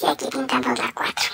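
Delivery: hushed counting whisper. Acted. Counting cow legs with kids.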